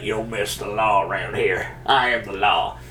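lawman roleplay line. Pretend. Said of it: "be like".

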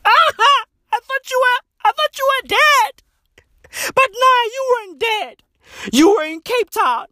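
high-pitched hammed-up wail; mock crying. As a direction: imitate a hysterical crying mother. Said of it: "as the lady's crying there".

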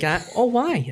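high-pitched American lady impression; imitated New York woman; American accent. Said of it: "and they were like".

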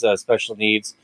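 usual speaking voice

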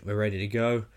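normal talking voice

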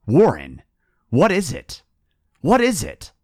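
high frantic repeated question; terrified cry. A narrator's reading. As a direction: cry out in terror. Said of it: "Terrified, I continued to repeat".

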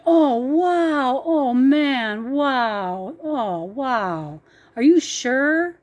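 high, loud amazed gasping, dropping to a hushed sure?; a stranger stunned by a hundred-dollar gift; amazed disbelief. Retold or imitated. Homeless man thanks for money.

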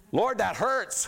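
high, loud cry of pain to the Lord; mock yell. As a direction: yell it up at God, hurting. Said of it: "Even though I'm yelling".

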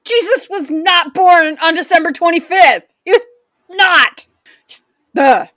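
loud, high indignant rant. Real, not acted. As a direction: vent your outrage loudly. Ranting about Hobby Lobby.

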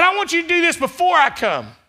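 loud, high preaching build; full-force preaching. Acted. Pastor preaching loudly to the congregation. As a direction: preach it out to the church.

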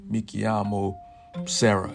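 normal talking voice

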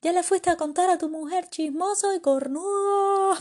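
high, loud taunting jeer, slowing at the end; mocking punchline shout. Biker taunts the man at the joke's punchline, loud. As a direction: crow the punchline at him. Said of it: "le dice".

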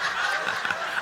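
short airy laugh at his own joke; soft laugh after the joke; breathy chuckle. Real, not acted. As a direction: let a quick unvoiced laugh slip out.

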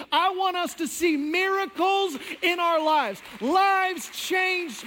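loud, high, emphatic preaching; full-force preaching. Acted. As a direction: preach it out with rising fire.

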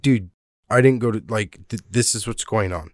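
usual speaking voice

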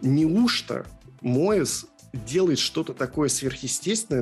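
usual speaking voice

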